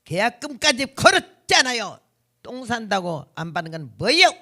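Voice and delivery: mock feisty comeback; forceful arguing. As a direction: argue back hard, as a retold quote. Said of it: "아주 말빨이 세요".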